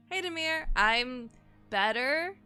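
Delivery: ordinary delivery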